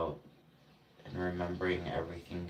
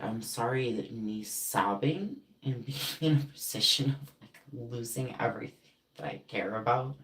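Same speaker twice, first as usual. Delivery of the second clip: raised, scornful retort getting louder; sarcastic apology; 5 semitones higher, 6 dB louder than usual. Retold or imitated. Answering the friend who blamed you, angry sarcasm. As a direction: throw the apology back angrily.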